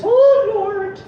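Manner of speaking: put-on high, loud childlike exclamation; little-girl nun. Praying nun hearing God behind her, high girlish voice. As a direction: gasp it in a tiny girl's high voice. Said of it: "She sounds a lot like the little girl".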